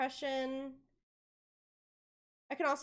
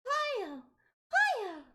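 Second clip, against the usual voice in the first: high, louder squeaky Kirby cry; Kirby hiya; 13 semitones higher, 5 dB louder than usual. Retold or imitated. Showing friend Kirby's cry, high squeak. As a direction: chirp it out like Kirby. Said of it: "So it's more like".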